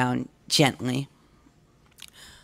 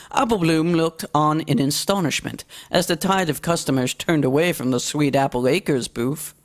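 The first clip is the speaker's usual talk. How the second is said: plain reading voice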